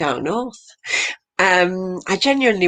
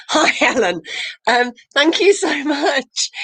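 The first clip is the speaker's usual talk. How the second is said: laughing; 9 semitones higher, slower than usual. Real, not acted. Host still laughing after act.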